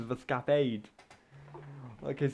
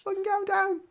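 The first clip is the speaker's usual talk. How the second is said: very high, squawking horse complaint; outraged horse; 3 dB louder than usual. Retold or imitated. Horses woken by sheep running round, high-pitched protest. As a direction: give voice to rudely woken horses. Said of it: "they're like".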